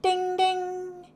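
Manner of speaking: high sing-song call of ding ding; cried out. A narrator's reading. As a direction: ring it out like an excited bell. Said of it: "everyone cried".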